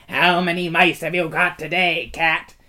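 high mocking creature voice; jeering. A narrator's reading. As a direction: taunt him with fake sweetness. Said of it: "jeering at him".

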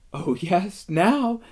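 put-on high, bubbling girl's voice; eager delight. A narrator's reading. Lucy jumping up and clapping, eager delight. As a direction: lift it with happy anticipation.

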